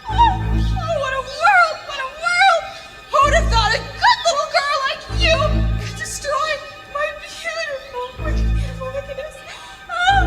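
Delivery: high, fading lament; dying wail. Acted. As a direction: moan as you dissolve.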